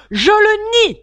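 very high, loud cry of denial; shouting. A narrator's reading. The hare denies the hatter's word, shouting. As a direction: holler it in protest. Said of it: "cria le lièvre".

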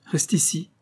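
ordre bref, voix plus haute; impérieuse. A narrator's reading. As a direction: commander sans réplique. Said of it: "dit impérieusement Rose". (ton impérieux)

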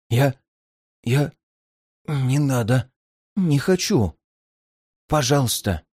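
faltering, pleading mumble; pitiful babbling. A narrator's reading. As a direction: beg in broken fright. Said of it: "жалобно лепетал мужчина".

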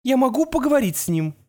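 high, hopeful asking; pleading mother. A narrator's reading. Mother asks on phone.

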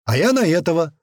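almost shout your bet; near shout. A narrator's reading. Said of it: "почти что крикнул мамуля".

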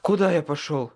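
winded, urgent question; panting, pressing question; out of breath. A narrator's reading. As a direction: blurt it out while short of breath. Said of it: "задыхаясь, прохрипел я".

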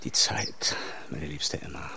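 whispered softly, consoling; soft whisper to the woman he loves; tender whisper. Acted. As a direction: breathe it to her like a secret comfort. Tender comfort to grieving Emma.

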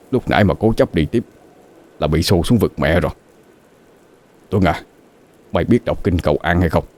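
subdued, urgent warning; frightened older driver. A narrator's reading. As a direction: warn the young one under your breath.

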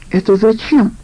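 ordinary delivery

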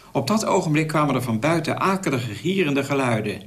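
ordinary delivery, nothing marked

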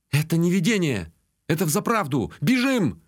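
high-pitched urgent warning; sudden danger, urge them to run; alarmed cry. A narrator's reading. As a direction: cry out in alarm, climbing to the last word. Sudden realisation of attack, urging flight.

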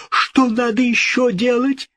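high-pitched anxious question; desperate cry. A narrator's reading. King asking the ghost for orders, desperate. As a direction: ask high, desperate to obey. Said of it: "вскричал король".

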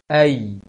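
"Ai" loud, a gliding up to i; ya sukun glide. Shown as a demonstration. Teacher demonstrating ya sukun glide, loud. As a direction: raise the tongue after the a. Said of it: "naikkan tengah lisannya ke langit".

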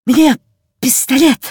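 высоко и громче, с разбегу; выпалить на выдохе, как подбежавший мальчик; запыхавшийся выдох. A narrator's reading. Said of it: "выдохнул он, подбежав поближе". (запыхавшись, по-детски высоко)

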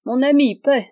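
high, loud cry; the old fisherman shouts at his friend to pay; shouted demand. A narrator's reading. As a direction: bark the order across the counter.

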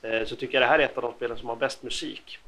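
normal talking voice

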